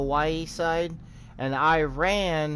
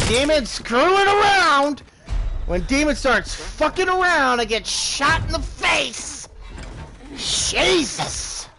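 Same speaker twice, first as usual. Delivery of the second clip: higher, louder, exasperated; real annoyance, half shouted; frustrated rant; 9 semitones higher, 4 dB louder, slower than usual. Real, not acted. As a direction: vent at yourself after dying. Complains after getting shot.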